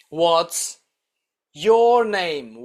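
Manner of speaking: ordinary delivery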